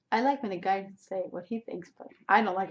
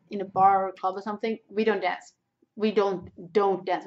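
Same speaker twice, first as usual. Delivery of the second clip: ordinary talk, nothing marked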